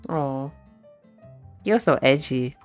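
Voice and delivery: ordinary talk, nothing marked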